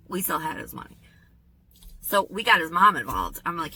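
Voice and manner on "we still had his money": unvoiced whispered aside; conspiratorial whisper. Real, not acted. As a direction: whisper it like a secret.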